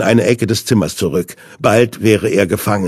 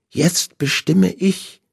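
higher, slow, soft menace; slow, higher villain voice; villain's voice; 8 semitones higher, 5 dB quieter, slower than usual. A narrator's reading. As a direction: Hinde claims control. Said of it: "sagte er".

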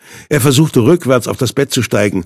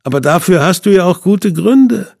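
higher, sneering villain voice; mock his bravery; Hinde, higher and sneering; 8 semitones higher than usual. A narrator's reading. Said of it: "spottete Hinde".

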